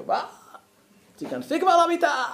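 hammed-up annoyed husband yelling; mock yell at home. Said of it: "כמו שאני צועק שמישהו מעצבן אותי".

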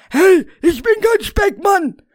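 high, loud indignant protest. Acted. Rainer protesting the insult, high and indignant. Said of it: "protestierte Rainer".

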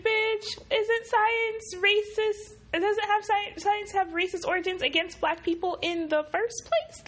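very high-pitched incredulous retort; indignant disbelief. Real, not acted. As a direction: fire back in exasperated high pitch. Indignant retort to racist science.

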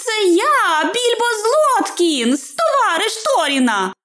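high-pitched hobbit announcement; calling out. A narrator's reading. Hobbit declares himself to searching man, high calling out. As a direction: announce yourself in a high, carrying voice. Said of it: "сказав він голосно".

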